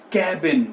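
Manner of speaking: "cabin" as KAB-in, short open A; correct pronunciation. Shown as a demonstration. Teacher leading learners to repeat, cabin as KAB-in. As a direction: model cabin with a falling tune. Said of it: "एक बार मेरे साथ बोलिए".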